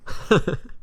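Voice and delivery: unvoiced, silent laugh; breathy laugh. Real, not acted. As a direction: laugh soundlessly at the absurd costume. Laughing at Warburton costume.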